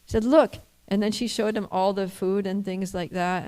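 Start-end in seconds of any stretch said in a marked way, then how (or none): 0.2-0.6 high, louder call of "Look"; wife calls him over to see; wife's loud command. Retold or imitated. Wife shows him the food.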